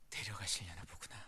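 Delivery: whisper. Retold or imitated. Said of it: "그 마음이었어요".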